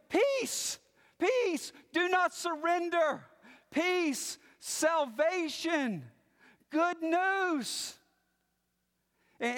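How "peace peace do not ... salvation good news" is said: high, exultant proclamation; joyful yelling. Acted. Runner yelling peace to the city watchmen, high exultant cries. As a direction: cry out each word to the watchmen on the walls. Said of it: "he's yelling".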